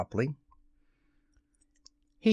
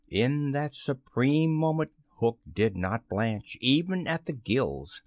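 usual speaking voice

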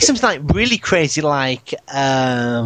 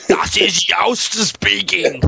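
put-on German accent; 5 semitones higher, slower than usual. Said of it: "No, that's more German".

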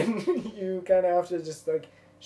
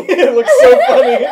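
loud laughing; 10 semitones higher, 16 dB louder than usual. Real, not acted. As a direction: laugh hard through it. Laughing loudly.

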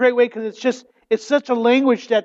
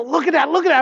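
excited; 6 semitones higher, much faster than usual. Retold or imitated. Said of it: "I was so excited".

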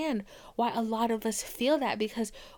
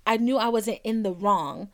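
ordinary delivery

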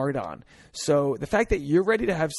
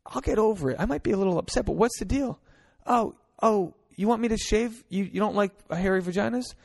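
put-on high-pitched girlfriend voice; man imitating a girl, high voice; playing the girlfriend; 5 semitones higher than usual. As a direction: play the girl confronting her boyfriend, pitched up. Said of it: "I'm being the girl right now".

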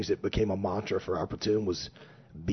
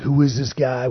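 louder, puzzled who is this; wondering aloud; 4 semitones higher, 8 dB louder than usual. Retold or imitated. Platoon members asking about their new boss, louder and puzzled. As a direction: call out the question in surprise.

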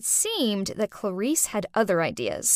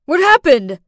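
loud, urgent question; frantic; 4 semitones higher, 11 dB louder than usual. Acted. Ethan rushing into the kitchen, frantic and loud. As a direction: burst in alarmed. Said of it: "Ethan frantically asked".

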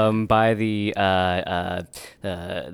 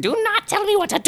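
very high, indignant squawk; hammed-up outrage. Stuck adventurer snaps at a meddling onlooker, mock outrage. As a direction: play it as high-pitched offended protest. Acted quarrel with child.

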